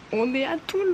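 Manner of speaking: high, thrilled rising cry; bursting with joy at arriving; excited. Real, not acted. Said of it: "J'ai envie de hurler".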